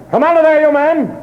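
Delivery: high shouted command; lieutenant orders the gang out under fire; calling out. Acted. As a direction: demand they come out now. Lieutenant calls men out again.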